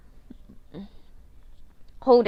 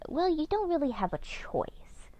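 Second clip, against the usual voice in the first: Death's line, whispered and higher; 8 semitones higher, 7 dB quieter than usual. Retold or imitated. Death telling the baby he has no choice, whispered. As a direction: breathe it like Death closing in. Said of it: "But Death's like".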